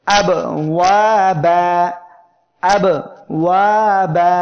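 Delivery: আবওয়াবা chanted slowly, loud, with a clean hamza stop; tajweed demo. Shown as a demonstration. Quran teacher demonstrating the hamza to students, slow and loud. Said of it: "খেয়াল করেন".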